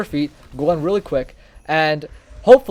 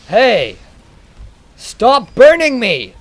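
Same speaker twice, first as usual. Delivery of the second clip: higher, loud, slow shout; real yell; 7 semitones higher, 8 dB louder, much slower than usual. Real, not acted. Player being burned by an enemy, yelling to stop. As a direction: yell it while being set on fire.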